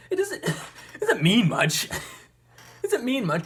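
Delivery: high, loud, laughing through the words; laughing disbelief. Real, not acted. Vlogger undercutting their own celebration of the charges, loud incredulous laughing. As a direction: let the laugh break up the line.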